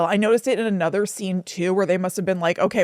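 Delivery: usual speaking voice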